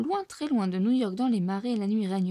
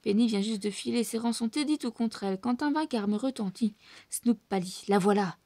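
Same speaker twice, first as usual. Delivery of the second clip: ordinary delivery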